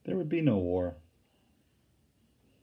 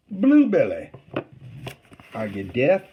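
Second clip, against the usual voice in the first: loud, higher call across the water; 3 semitones higher, 6 dB louder than usual. A narrator's reading. Rebel picket shouting across the river to Charlie, loud and high.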